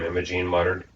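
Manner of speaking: usual speaking voice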